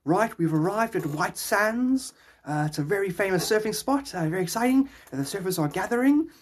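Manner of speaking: mock on-the-scene sports presenter; surf reporter character. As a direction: put on a hammed-up live surfing reporter. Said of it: "he'd carry on with that character".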